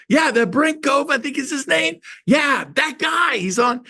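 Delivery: high mock office holler; calling to coworker. Receptionist confirms the caller to her coworker, imitated high-pitched calling. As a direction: mimic an excited receptionist confirming. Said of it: "She muffles the phone, but I could still hear".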